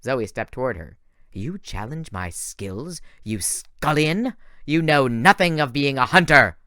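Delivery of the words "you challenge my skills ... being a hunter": slow, cold, then louder huntress challenge; haughty challenge. A narrator's reading. Zoe stepping toward Thalia in contempt, slow and rising. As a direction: look down on her and rise to fury.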